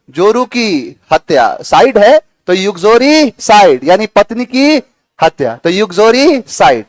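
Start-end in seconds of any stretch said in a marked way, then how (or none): none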